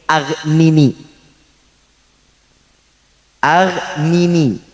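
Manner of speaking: Aghnini stretched very slow with a falling close; slow drawn-out Aghnini. Shown as a demonstration. Preacher teaching the closing word, drawn out very slowly. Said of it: "Aghnininya dibaca kapan".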